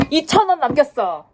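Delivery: annoyed outburst. Real, not acted. Complaining about bad salt bread.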